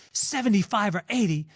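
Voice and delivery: can't believe what he just heard; incredulous disbelief. Retold or imitated. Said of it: "almost came across his desk".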